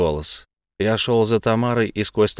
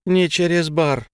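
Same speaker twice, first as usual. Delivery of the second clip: high, slow curt order; sharp fairy command; pixie; 7 semitones higher, slower than usual. A narrator's reading. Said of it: "бросила фея своим".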